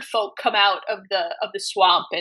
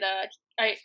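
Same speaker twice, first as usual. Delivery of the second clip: ordinary talk, nothing marked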